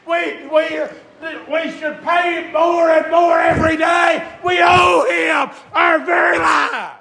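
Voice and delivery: high, loud, slow climbing sermon shout; preaching at full force. Acted. Preacher shouting hope to the congregation, full force. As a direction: build to a roaring preacher's cry.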